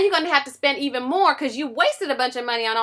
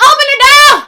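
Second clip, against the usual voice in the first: high loud desperate yell; terrified pig shouting; frantic shout; 13 semitones higher, 15 dB louder, faster than usual. Acted. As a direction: scream at her to open up. Pigs beg sister.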